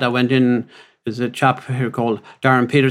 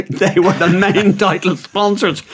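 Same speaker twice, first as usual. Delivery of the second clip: laughing on the words, drawn out and a little higher; laughing through it; 5 semitones higher than usual. Retold or imitated. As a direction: let the giggles break up the line. Retelling the sponsor mix-up.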